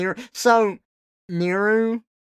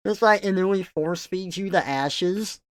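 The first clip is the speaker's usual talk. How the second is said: normal talking voice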